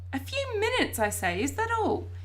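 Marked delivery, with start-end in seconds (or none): 0.1-1.0 very high-pitched disbelief; incredulous. A narrator's reading. Boy hearing how briefly he was shut in, incredulous. 1.4-2.1 very high, astonished question; incredulous. A narrator's reading. Boy astonished by his dad's answer, incredulous.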